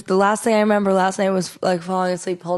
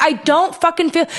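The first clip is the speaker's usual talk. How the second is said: high-pitched irritated protest; pissed-off retort; 8 semitones higher than usual. Retold or imitated. Sick woman arguing with her partner, pissed off. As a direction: protest it in a raised, annoyed pitch. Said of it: "I got so pissed".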